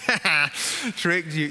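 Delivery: mock taunt. Mock cruel parent joke.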